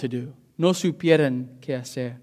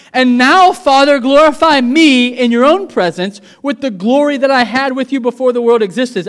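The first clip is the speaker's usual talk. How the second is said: high, loud preacher's cry; full-force preaching; 8 semitones higher, 9 dB louder than usual. Acted. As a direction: preach it out to the whole church with fire. Preaching in two languages.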